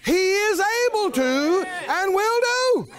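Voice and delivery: high, slow, shouted declarations; rousing sermon climax; preaching at full force. Real, not acted. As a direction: build the congregation up with fire. Preaching build.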